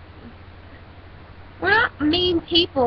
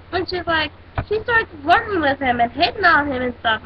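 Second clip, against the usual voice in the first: ordinary delivery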